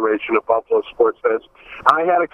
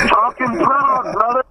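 slow, much higher friendly mock O J voice; imitating O J; 11 semitones higher than usual. Recalling O J greeting a Buffalo fan, slow high imitation. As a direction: stretch it out like a celebrity greeting a fan. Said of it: "that was O J's way of connecting with me".

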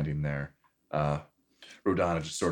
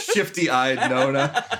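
laughing; 10 semitones higher, 8 dB louder, slower than usual. Real, not acted. GM cracking up at player.

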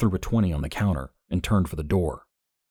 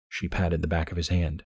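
plain reading voice

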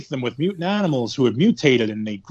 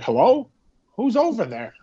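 calling out; 7 semitones higher than usual. Retold or imitated. Victim calls out on tracks.

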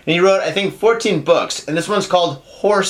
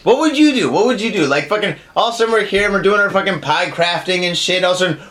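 ordinary talk

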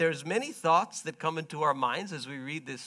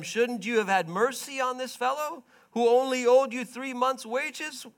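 loud, higher, indignant rebuke; angry rebuke; 8 semitones higher, 6 dB louder than usual. Acted. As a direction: demand why he showed no mercy. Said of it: "In anger".

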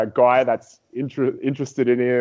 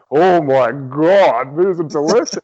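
slow, loud savouring exclamation; hammed-up delight; 2 semitones higher, 5 dB louder, slower than usual. Husband tasting French toast sticks for the first time, hammed-up delight. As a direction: draw out the mock rapture over food. Re-enacting his first ecstatic taste.

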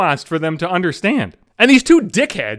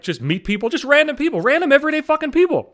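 normal talking voice

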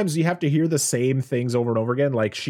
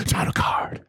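playful whispered echo. Pretend. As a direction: whisper it like a caption.

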